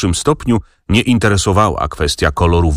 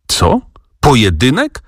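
higher, rising in surprise; excited shout; 5 semitones higher than usual. A narrator's reading. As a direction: blurt it out, startled and eager. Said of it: "krzyknął z błyszczącymi oczyma". (okrzyk zdumienia)

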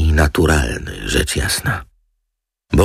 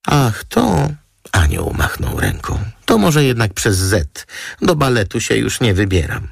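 high, carefree model voice; light, high-voiced chatter; breezy model; 8 semitones higher than usual. A narrator's reading. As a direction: wave it off, pitched well up. Model waves it off.

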